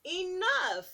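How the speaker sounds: loud, high cry of enough; exasperated shout. Acted. Reading to kids, boy shouting he's had enough. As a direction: burst out fed up. Story figure fed up with elephants.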